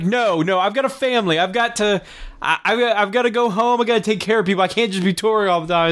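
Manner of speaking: mild mock yell; imitated angry yelling. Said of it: "angrily starts yelling about how tired he is".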